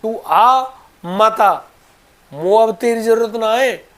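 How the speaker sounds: high, loud indignant retort; acted anger. Baba tells Thakur ji he is not needed, acted anger. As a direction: scold loudly as the fed-up old devotee. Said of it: "वो बिल्कुल गुस्से में".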